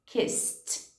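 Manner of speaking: "Kissed" ends in a crisp t, one syllable; teacher models "Kissed" as kis-t. Shown as a demonstration. Teacher shows students the past tense. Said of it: "você vai falar kissed".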